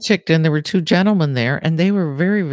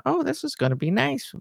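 hopeful inner thought; 13 semitones higher, 6 dB quieter than usual. Retold or imitated. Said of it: "And I thought".

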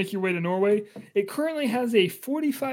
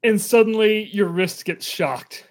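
usual speaking voice

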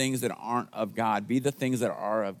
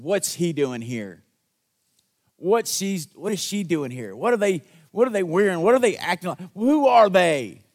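rising, louder scandalized questions; judgmental church member; 7 semitones higher, 6 dB louder than usual. Retold or imitated. Churchgoer sneering at a visitor, growing loud and scandalized. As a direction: sneer at the newcomers. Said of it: "someone came up to me and said".

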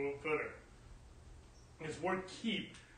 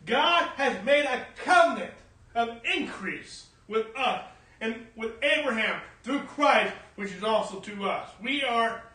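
higher, forceful sermon voice; full-force preaching; 7 semitones higher, 6 dB louder than usual. Real, not acted. Preacher declaring God's covenant to the church, emphatic preaching. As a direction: preach it out with punched key words.

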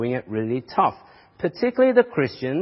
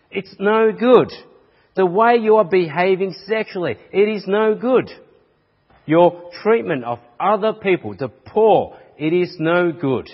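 a preacher's rising rebuke, louder on each blow; preaching rebuke; 3 semitones higher, 3 dB louder than usual. Acted. Preacher listing the church's failings to the congregation, building rebuke. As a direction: hammer each failing home from the pulpit.

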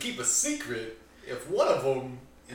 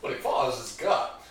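narrator voice; 4 dB louder than usual. Acted. Narrator intro of wandering character.

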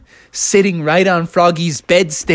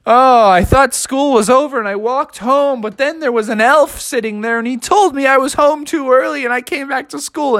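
high, breathless-sounding run-on explanation; flustered excuse; 10 semitones higher than usual. Acted. Froggy explains himself to the teacher, flustered. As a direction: babble out your defence.

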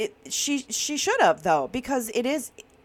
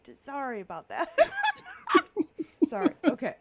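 laughing apology; 7 semitones higher, faster than usual. Real, not acted. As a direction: apologize while you can't stop laughing.

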